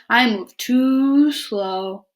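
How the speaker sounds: very slow, drawn-out turtle voice. Acted. Turtle refusing to deliver invitations, very slow voice. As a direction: stretch each word out, turtle-lazy.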